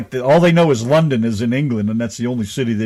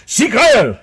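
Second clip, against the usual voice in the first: joking shout, higher and louder; mock German salute; 10 semitones higher, 5 dB louder than usual. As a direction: bark it out as a teasing German salute. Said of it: "she does do that German thing with me".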